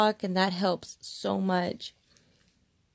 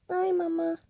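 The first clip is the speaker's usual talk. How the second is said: high, playful pet voice; voicing the dog; 9 semitones higher than usual. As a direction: speak for the dog, squeaky and sweet. Voicing the dog's reply.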